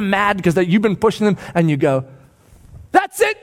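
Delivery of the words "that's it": high-pitched triumphant cry; excited parent. Acted. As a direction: burst out at a child's breakthrough. Parent cheering child's breakthrough.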